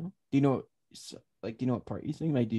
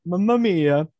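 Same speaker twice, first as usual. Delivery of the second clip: Italian accent; 6 semitones higher, 9 dB louder, slower than usual. Shown as a demonstration. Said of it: "Italian accent".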